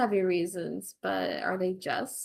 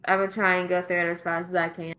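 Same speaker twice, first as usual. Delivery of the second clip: fast talking; 6 dB louder, much faster than usual. Real, not acted. GM rushing against time.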